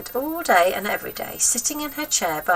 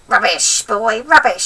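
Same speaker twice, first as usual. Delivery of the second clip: bossy wicked granny, pitched up and loud; grandma voice; 2 semitones higher, 9 dB louder than usual. Acted. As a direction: nag the boy like a spiteful old grandma.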